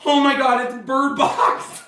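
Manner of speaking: high, loud cry of alarm; hammed-up panicked yell; panicked shout. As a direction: shout a sudden terrified realization, acted out. Acted panicked cry to husband.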